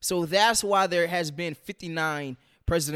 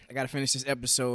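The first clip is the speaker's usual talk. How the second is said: ordinary talk, nothing marked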